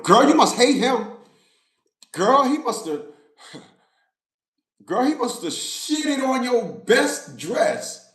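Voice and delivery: put-on high sassy girlfriend voice, drawn out. Woman mocking another about her ex, sassy girlfriend voice. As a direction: play the gossiping girlfriend egging her on.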